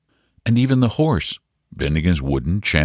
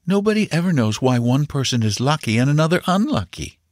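higher-pitched, instructive mother's voice; mother explaining; 9 semitones higher than usual. A narrator's reading. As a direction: spell it out patiently for a child.

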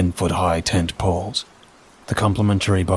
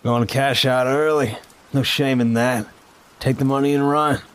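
high, smug, knowing voice; smug official; 7 semitones higher than usual. Acted. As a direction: toss it off with a smug smirk. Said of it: "A smug, high-ranking official".

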